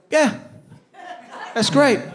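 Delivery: high, forced praise; false encouragement. Acted. Dad on the couch praises son, high forced cheer. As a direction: cover your doubts with a lifted pitch. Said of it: "And instead, you just go".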